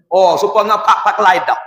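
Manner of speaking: ordinary talk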